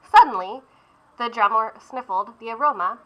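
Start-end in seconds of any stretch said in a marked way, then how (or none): none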